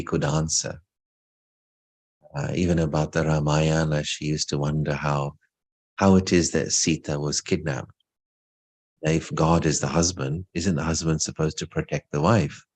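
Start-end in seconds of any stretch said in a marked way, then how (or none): none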